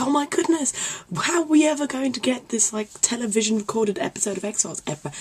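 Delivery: high-pitched mock dismay; mortified. Retelling being a mortified young fan, high mock panic. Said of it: "we would be mortified".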